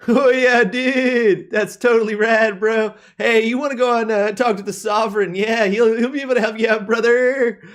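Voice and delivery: high, loud laid-back surfer dude; surfer bro voice. Acted. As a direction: chill bro calling everyone dude. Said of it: "giving them this voice".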